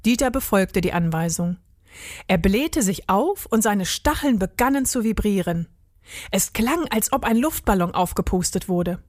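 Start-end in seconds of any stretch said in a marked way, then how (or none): none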